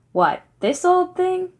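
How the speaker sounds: high, slow disbelieving scoff; incredulous scoff. Acted. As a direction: scoff at the shabby old book in disbelief. Fischel scoffs at the book.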